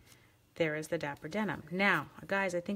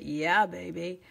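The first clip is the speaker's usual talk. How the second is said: playful catchphrase impression, lightly done; lightly hammed-up swinger voice; Austin Powers; 4 dB louder, much slower than usual. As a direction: have a go at a cheeky movie-spy catchphrase. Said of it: "Austin Powers, can I do that?".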